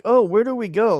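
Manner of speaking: high, lilting imitation of holiday-goers; mock carefree vacationer. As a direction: ask it like a clueless tourist. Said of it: "Like, they're going on vacation".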